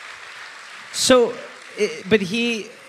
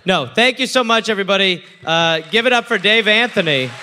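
loud, high thank-you to the whole room; big, bright sign-off shouted to the audience; calling out to crowd; 7 semitones higher, 7 dB louder than usual. Real, not acted. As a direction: project it over the applause to the crowd. Thanking live audience at close.

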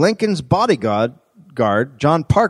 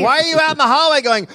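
mock scolding; 11 semitones higher, faster than usual. Acting out scolding the yelling man.

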